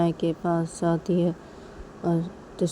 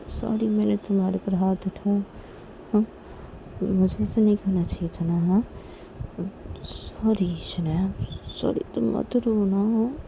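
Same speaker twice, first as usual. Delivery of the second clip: put-on higher, soothing girl's voice begging her not to cry; consoling apology; 4 semitones higher than usual. Tisha soothing crying Shanaya, higher pleading voice. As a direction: comfort her and plead at once.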